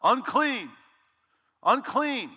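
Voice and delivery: high, loud warning cry, repeated; crying out unclean. Retold or imitated. Leper warning the town, loud high cry. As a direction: call out like a leper warning the street. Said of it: "you had to cry out".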